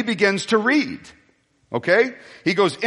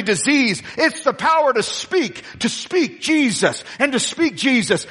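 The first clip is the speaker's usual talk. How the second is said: rising, building sermon cadence; preaching; 4 semitones higher than usual. Acted. Pastor preaching to the church, building in force. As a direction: lift the church with each line.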